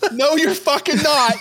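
shouting no through laughter; laughing loud refusal. Real, not acted. Laughing refusal at palm-scanning idea.

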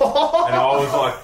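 high squealing laugh; laughing shriek. Retold or imitated. Panicking host laughing shrill.

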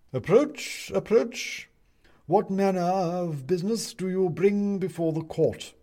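high-pitched judicial summons; pompous owl judge. Acted. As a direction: question them from the bench in a high voice. Owl judge questions Bobs.

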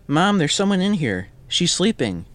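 put-on high, loud little boy voice; high-pitched boy reporting to his mom; boy calling out. A narrator's reading. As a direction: call to mom in a high child's voice. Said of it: "the boy says".

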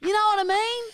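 very high, loud squawk of complaint; mock outburst. Accused friend hitting back, high mock-indignant voice. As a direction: protest in a high, loud put-on voice.

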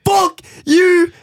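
hammed-up rage, loud and high; mock angry shout. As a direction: yell it at the airline. Cursing the airline Kiwi.

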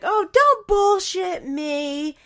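high, loud and slow scornful retort; mock scoff. Grandmother scoffs at the boyfriend, slow and scornful. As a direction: drag it out with contempt. [name] snapping back.

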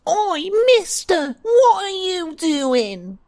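put-on high, loud street-kid snarl; a cheeky child snarls at the thief. Street kid snarling at the player who bumped him. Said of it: "turns around and snarls and goes".

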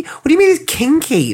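high incredulous squeak. Real, not acted. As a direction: leap up high in disbelief. Incredulous outburst.

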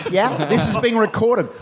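call centre reply. Retold or imitated. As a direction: answer like a flustered phone operator.

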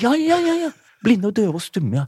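high, stunned ja ja ja; stunned mock agreement. Comedian hears the audience is blind and deaf, high stunned agreement.

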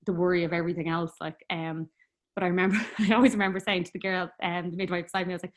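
ordinary delivery

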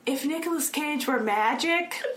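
weird high joke voice. Retold or imitated. Retelling how her friend told a joke, weird high voice. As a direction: lift it high and slow, oddly sing-song. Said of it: "she said it in the weirdest way".

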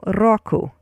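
"roku" slowly with trilled R, RO-ku; rolled R. Shown as a demonstration. Teacher models the word, "roku" slow with rolled R. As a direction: roll into "roku" like rock plus oo. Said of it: "roll your R".

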